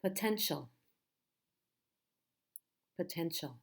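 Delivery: "Potential" with the stress on ten; stress middle syllable. Shown as a demonstration. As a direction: make ten the strong beat in "Potential". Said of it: "It's the middle syllable".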